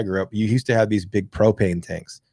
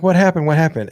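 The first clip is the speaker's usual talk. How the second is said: alarmed mom; 6 semitones higher, 4 dB louder, faster than usual. Retold or imitated. Mom voiced in story.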